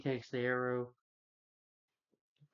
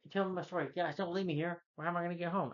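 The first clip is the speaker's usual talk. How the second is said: high imitation of a stranded storyteller; mock pleading; 6 semitones higher than usual. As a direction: call after them in a pitched-up, pleading impersonation. Stan Lee calls after departing listeners.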